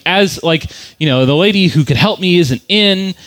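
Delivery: ordinary talk, nothing marked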